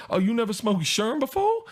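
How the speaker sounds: high-pitched comic impression; high voice swinging up into a question; Tracy Morgan. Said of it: "And he's like".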